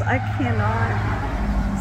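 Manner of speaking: a touch of overexcited pleading; hyped up. Retold or imitated. Man confessing to his old love, mildly hyped up. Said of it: "It just sounded really hyped up".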